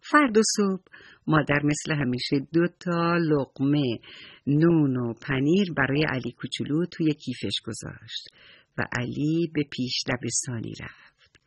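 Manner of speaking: ordinary delivery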